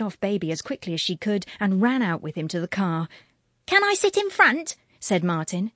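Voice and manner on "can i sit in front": put-on high, loud child's voice asking; eager little boy. A narrator's reading. Boy asking parents for front seat, high eager child. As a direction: pipe up like a small boy wanting the front seat.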